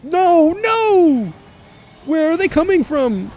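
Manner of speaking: high, loud panicked cries; shouting in alarm; panicked yelling. Real, not acted. Ambushed in game.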